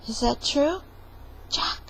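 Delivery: ordinary delivery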